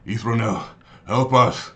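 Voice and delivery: loud acted cry for help; yelling for help. Tyron yells to the abbot for help, loud. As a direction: call out across the distance, loudly. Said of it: "Tyron yells".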